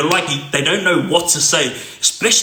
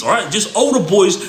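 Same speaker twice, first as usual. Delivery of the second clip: ordinary talk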